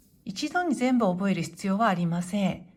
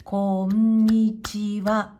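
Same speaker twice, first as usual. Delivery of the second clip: こんにちは drawn out slowly, each mora equal and level; slow, loud, even-beat demo of こんにちは; same rhythm on every sound; 5 dB louder, much slower than usual. Shown as a demonstration. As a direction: hold every sound of こんにちは equally long on one pitch. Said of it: "だいたい同じリズムで発音しま".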